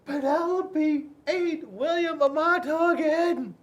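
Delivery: put-on high, loud kid yelling out; tattling shout. A narrator's reading. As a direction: shout it to tell the teacher. Kid yells tattling in class.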